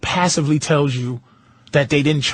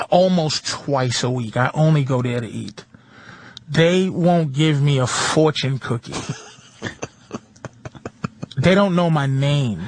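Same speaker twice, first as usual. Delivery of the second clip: ordinary talk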